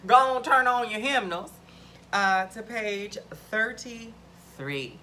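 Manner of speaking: loud, high congregational call; playful church announcer voice; church leader. Acted. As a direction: summon the congregation with gusto. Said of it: "That's what they say in church".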